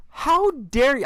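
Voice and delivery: high hammed-up indignation; play-acted offended fan; mock outrage. Son retelling his reply to mom, mock outraged. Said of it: "I was like".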